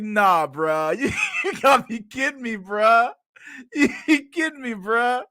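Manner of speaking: high-pitched, cracking up mid-line; wheezing with laughter at a video; laughing disbelief. Real, not acted. Reacting to a corny intro, laughing.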